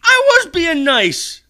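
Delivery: high, loud, slow indignant whine; whiny protest. Real, not acted. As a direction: protest your innocence, drawn out. Protesting a friend's rebuke.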